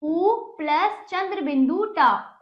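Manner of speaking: normal talking voice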